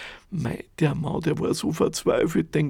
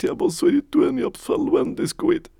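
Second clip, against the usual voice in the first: wailing; 12 semitones higher, 3 dB louder than usual. Acted. Poor man wailing his loss.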